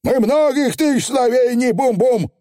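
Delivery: high proud little Feegle; high cocky pixie; Feegle boast. Acted. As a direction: brag about not knowing words.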